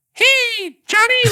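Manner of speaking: very high squeaky put-on child; mimicked kid voice. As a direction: call out to a pal in a falsetto kid impression. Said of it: "all those kids are gonna tell their friends".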